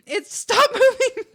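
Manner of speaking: high and loud, slow, laugh breaking the words; stop, stop, and crack up halfway; giggling so hard the plea stretches out. Real, not acted. Same plea dissolving into laughter.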